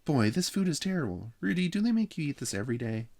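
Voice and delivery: put-on high, loud kid voice; complaining little boy. A narrator's reading. Jeffrey complaining to Rudy about food, high loud child voice. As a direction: gripe high and loud, then hurry the question.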